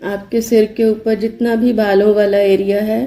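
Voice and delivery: even, unhurried meditation voice with long pauses; guided meditation. Acted. Yoga teacher leading listeners to relax body and brain, guided meditation. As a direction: guide them steadily into relaxation, leaving space after each instruction.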